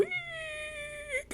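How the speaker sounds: put-on very high, thin squeak of an old hinge; high, faint imitated door creak. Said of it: "I can hear the creaking like an old door go".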